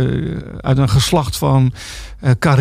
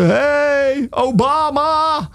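high, drawn-out mock holler; imitated shout; 11 semitones higher, much slower than usual. Imitating someone shouting over the canal at Zwarte Piet, mock shout. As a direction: call it out long and high, as if across water. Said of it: "heel hard iemand over de gracht schreeuwde".

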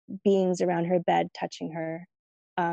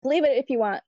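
higher-pitched, mildly frustrated retort; imitated frustration; 6 semitones higher than usual. Dad answering daughter's many questions, mock frustrated. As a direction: insist like an exasperated dad, playfully. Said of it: "he would get frustrated".

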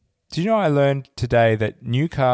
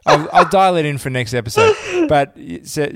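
laughing; 5 semitones higher, 5 dB louder than usual. Real, not acted. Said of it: "laughing at own attempt".

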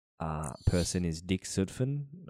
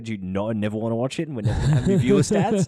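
mock studio announcement; 5 semitones higher, 5 dB louder than usual.

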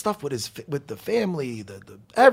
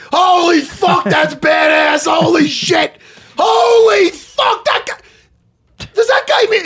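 much higher, loud, excited yelling with laughs; awed shouting; 9 semitones higher, 4 dB louder, slower than usual. Real, not acted. Staring at the fight photo, loudly awed and thrilled. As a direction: shout in real amazement at the picture.

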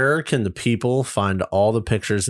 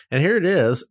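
higher proud presenting voice; proud reveal; 7 semitones higher than usual. Retold or imitated. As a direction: show it off, pleased with yourself. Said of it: "I'm like".

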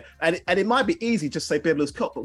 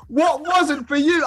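laughing; 8 semitones higher, slower than usual. Real, not acted. Laughing disbelief.

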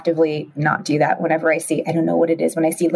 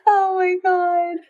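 laughing; 12 semitones higher, slower than usual. Real, not acted. Laughing at the story.

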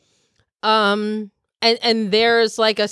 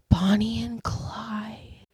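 whisper; 7 dB quieter, slower than usual. Acted. Whispered legend name.

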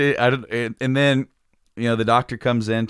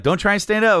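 staff warning; 11 semitones higher, 3 dB louder than usual. Retold or imitated. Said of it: "they're like".

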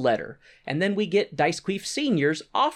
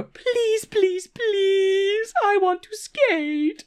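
very high, drawn-out falsetto pleading; hammed-up childish begging; begging showgirl; much slower than usual. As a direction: wheedle in a put-on girly squeal. Showgirl begs again, acted.

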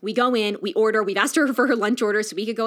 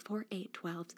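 counting under breath; 3 semitones lower, 18 dB quieter, slower than usual. Retold or imitated. Counting weeks on her fingers.

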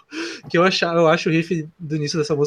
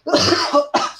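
sharp choking cough; coughing up a bug; 12 semitones higher than usual. Real, not acted. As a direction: cough out a swallowed mosquito. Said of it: "engoli um mosquito aqui".

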